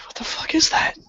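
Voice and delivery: high, breathless frightened whisper; scared whisper. Retold or imitated. Hiding in the pool as the light nears, scared whisper. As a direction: hiss it out, spooked.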